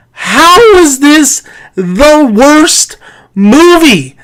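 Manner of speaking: "How is this the worst movie" slow, high, each word punched; exasperated, drawn-out stress on "worst movie"; exasperated emphasis. Real, not acted. Exasperated rant, question repeated word by word.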